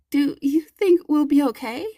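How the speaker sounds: put-on a dry, shallow voice from a scared captive girl; weak frightened girl. A narrator's reading. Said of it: "Her voice is dry, weak, and shallow".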